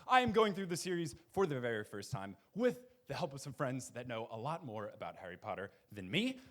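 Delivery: ordinary talk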